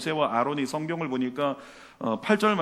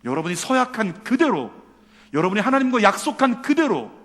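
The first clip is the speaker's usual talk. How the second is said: loud, raised preaching cry; impassioned exhortation; 6 semitones higher, 7 dB louder than usual. Real, not acted. Preacher urging the congregation to keep their vows, impassioned. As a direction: drive the promise home with force.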